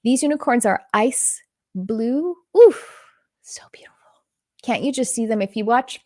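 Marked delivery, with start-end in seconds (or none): 2.6-3.0 high, loud "oof" of awe; sudden high-pitched breath of wonder; awed gasp. Real, not acted. Channeler struck by the ice blue unicorns, loud awed oof. Reacting to a beautiful vision. 3.4-3.9 very soft, breath-only murmur; awed whisper. Real, not acted. Channeler moved by the ice blue unicorns, awed whisper.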